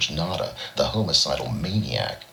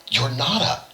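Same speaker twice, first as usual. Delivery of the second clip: surprised gasp, much higher; 12 semitones higher than usual. A narrator's reading. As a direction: gasp it out in shock. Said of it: "he gasped".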